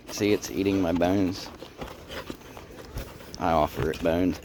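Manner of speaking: normal talking voice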